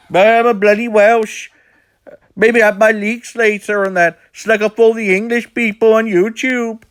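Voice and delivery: high, loud mock-Welsh accent; Taffy. Shown as a demonstration. Resuming the Taffy impression for viewers, high mock-Welsh accent. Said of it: "I'm losing my accent a bit".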